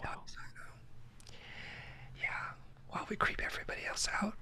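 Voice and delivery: soft breathy ASMR whispering. Acted. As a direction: whisper close to the mic.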